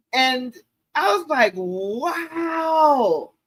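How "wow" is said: amazed wow. Retold or imitated. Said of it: "I was blown away".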